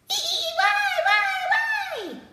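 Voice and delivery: very high squeaky monkey. Acted. Monkey asks the jellyfish why, very high and squeaky. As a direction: chatter it like a curious monkey.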